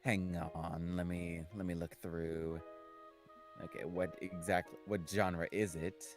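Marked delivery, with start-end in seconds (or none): none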